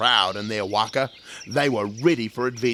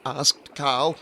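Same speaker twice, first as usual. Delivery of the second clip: plain reading voice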